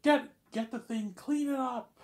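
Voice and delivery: high, loud and slow barked order; imitated furious command; mock teacher yelling. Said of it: "She's like".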